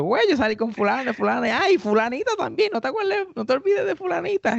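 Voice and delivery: mock-pestering friend, going very high. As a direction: needle him in a high voice. Friend teasing about a bad date.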